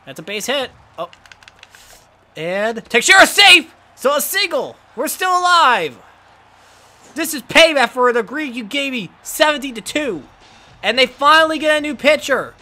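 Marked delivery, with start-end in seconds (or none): 2.4-12.5 high, loud gleeful taunting; triumphant gloating. Real, not acted. Gamer gloating at the opponent after a rally, loud and high.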